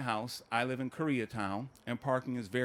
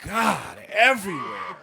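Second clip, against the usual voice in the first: loud laughing rave about the women; excited gush; 5 semitones higher, 8 dB louder, slower than usual. Retold or imitated. Teacher gushing to sixth graders about the premiere, louder and laughing. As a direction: build to a delighted laughing shout. Retelling gushing to his class.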